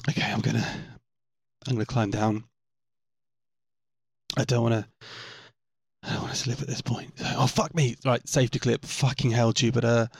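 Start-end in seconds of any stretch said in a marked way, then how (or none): none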